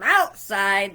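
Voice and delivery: a touch of bellow. Shown as a demonstration. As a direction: punch it out as if outdoors. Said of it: "that is my bellowing voice".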